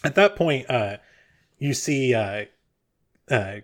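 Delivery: ordinary delivery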